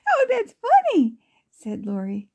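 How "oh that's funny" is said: high-pitched giggle into a delighted remark; laugh, then speak up high like a tickled girl; amused girl. Acted. Girl laughing at Grammy's story.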